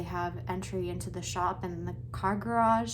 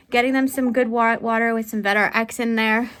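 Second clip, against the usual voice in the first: usual speaking voice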